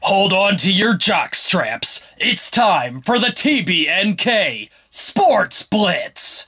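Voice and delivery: slow announcer voice. Acted. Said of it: "show segment announcement".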